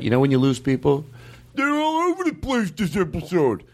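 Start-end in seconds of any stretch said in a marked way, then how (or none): 1.5-3.6 high, slow dopey voice mocking a listener; dumb listener impression. [name] mocks a listener who quits his show in a high, dopey voice. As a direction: sneer it like a slow-witted critic. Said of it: "that's how they sound when I do an impersonation of 'em".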